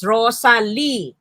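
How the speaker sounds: "Rosalie" slowly in three beats, RO-SA-LIE; three syllables. Shown as a demonstration. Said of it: "Then the three syllables".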